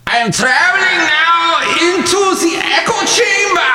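high shouted cartoon duck voice; Scrooge McDuck voice. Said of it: "And he's like".

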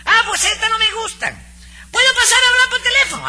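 hammed-up falsetto of a fussy customer; mocking Nananina's voice. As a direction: mimic the customer in a shrill falsetto. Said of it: "yo hablo así con esa voz".

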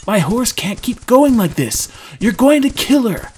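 high, loud plea; urgent protest. Acted. Drew pleads with Trendon for his horse, shouting.

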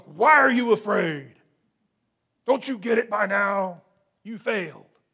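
loud, harsh authority figure; tyrannical. Acted. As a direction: berate them as an abusive boss would. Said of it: "may be tyrannical or abusive".

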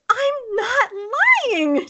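very high, stretched-out whine; high-pitched, drawn-out indignant denial; mock child's protest. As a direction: wail it like a wrongly accused kid. Child protests liar accusation.

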